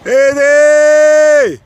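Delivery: very high, very loud drawn-out shout of his name; long yelled call. Real, not acted. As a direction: bellow it across the slope after him. Final call as seagull soars away.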